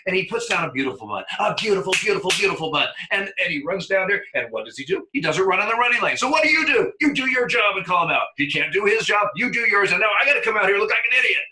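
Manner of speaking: high, loud mock fury, fast at the end; loud, high play-acted outrage; manager's mock tirade. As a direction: ham up the rage for the crowd. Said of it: "and he goes".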